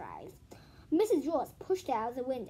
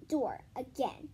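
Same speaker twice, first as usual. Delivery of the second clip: ordinary delivery, nothing marked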